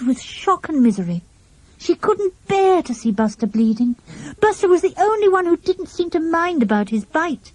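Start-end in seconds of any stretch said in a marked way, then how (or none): none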